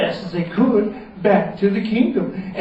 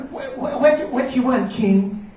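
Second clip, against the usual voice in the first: flustered wizard; 7 semitones higher than usual. Acted. Wizard answers king.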